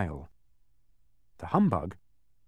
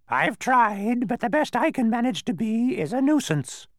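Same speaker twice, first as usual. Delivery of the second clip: high, loud, doleful squawk; tearful-character bird screech; sad bird; 7 dB louder than usual. A narrator's reading. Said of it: "he replied sadly".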